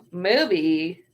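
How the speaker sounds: ordinary delivery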